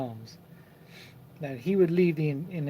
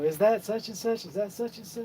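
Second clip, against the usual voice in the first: teasing; 7 semitones higher than usual. Retold or imitated. Teasing girl at school pickup.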